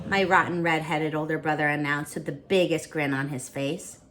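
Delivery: plain reading voice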